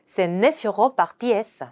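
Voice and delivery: very slow, "neuf" stressed; careful slow French; slow repeat. Shown as a demonstration. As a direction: say it again slowly for class. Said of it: "한 번 더".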